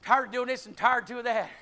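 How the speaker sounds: ordinary delivery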